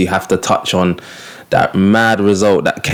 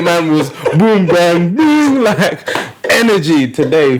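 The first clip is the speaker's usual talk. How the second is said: imitating hype; 8 semitones higher than usual. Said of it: "Man was, was, the other day".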